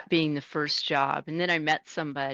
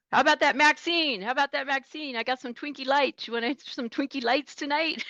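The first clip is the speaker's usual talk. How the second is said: higher playful radio tease; teasing trucker; 9 semitones higher than usual. Retold or imitated. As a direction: tease her over the CB. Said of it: "he'd be like".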